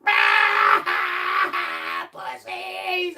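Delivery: drawn-out taunting yell at the cops; mocking yell. Taunting the cops from the beach, mocking yell. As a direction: jeer it up the bank.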